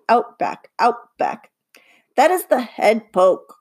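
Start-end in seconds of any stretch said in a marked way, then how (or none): 2.1-3.5 lightly voiced impatience, slower; a touch of offended impatience; impatient. Bob explaining the chicken walk to Livy, mildly impatient. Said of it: "he says impatiently".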